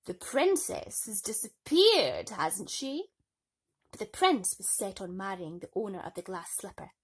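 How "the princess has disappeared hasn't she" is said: sly stepmother. Acted. Stepmother continues.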